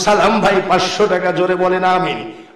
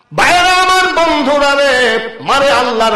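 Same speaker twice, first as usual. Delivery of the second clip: rousing preacher's call; 7 semitones higher, 5 dB louder than usual. Real, not acted. Preacher rallying friends outside, high chanted call. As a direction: call out high, then ease down. Calls out to the crowd.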